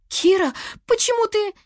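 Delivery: high, alarmed question; frightened. A narrator's reading. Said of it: "испуганно начала Лиана". (испуганно, высоким голосом)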